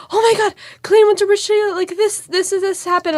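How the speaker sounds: high-pitched, imitated panicky gushing; retold freak-out. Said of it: "everyone was, like, freak out".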